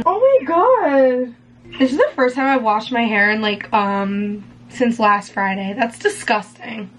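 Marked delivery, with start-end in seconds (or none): 0.0-1.3 slow, high, loud exasperation; drawn-out oh my god. Real, not acted. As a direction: stretch it out in real distress. Cream starting to itch.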